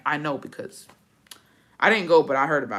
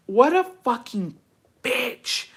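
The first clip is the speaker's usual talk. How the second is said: high, slow disgust into voiceless spat word; real outrage; 7 semitones higher, much slower than usual. Real, not acted. Host reacting to letter about mother-in-law, slow outrage. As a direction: draw it out, then spit the last word.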